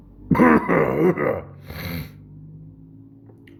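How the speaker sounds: harsh cough from a sore throat; loud hacking cough; painful cough. Real, not acted. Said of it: "it hurts to swallow".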